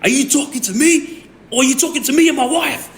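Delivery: high, strained mock yell; retold yelling back. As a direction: re-enact yelling back at police. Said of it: "I just yelled at him".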